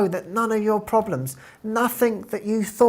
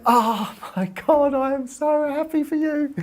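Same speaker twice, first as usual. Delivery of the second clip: thrilled; 8 semitones higher than usual. Retold or imitated. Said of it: "And I was just like".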